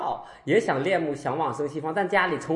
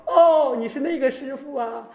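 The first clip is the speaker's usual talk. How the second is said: loud high "oh" as it dawns; sudden recognition; 10 semitones higher, 5 dB louder than usual. Retold or imitated. Old devotee finally places the master, exclaiming. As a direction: act the penny dropping, pitched high.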